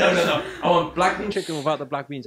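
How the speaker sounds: high, loud protest through laughter; laughing mock insistence. Customer correcting the cook on a takeaway order, laughing mock insistence. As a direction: imitate an insistent customer while cracking up.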